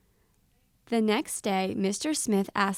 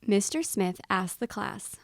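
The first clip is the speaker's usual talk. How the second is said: ordinary delivery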